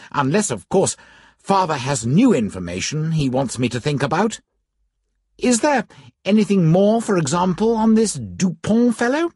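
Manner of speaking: put-on eager, lifted young man's voice; young gentleman voice. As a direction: play him keen and youthful. Narrator voices young Cardinal.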